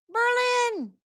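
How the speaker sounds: high-pitched, playful whoop of the word; sudden high squeal of a greeting; playful high call-out. Pretend. Calls the town out again playfully.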